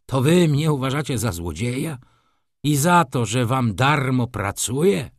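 ordinary delivery, nothing marked

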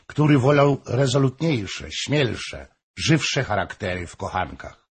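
ordinary delivery, nothing marked

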